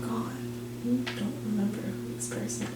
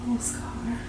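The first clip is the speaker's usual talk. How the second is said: scolding figure, hushed; 3 semitones higher, 5 dB quieter than usual. Acted. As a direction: whisper a disappointed telling-off.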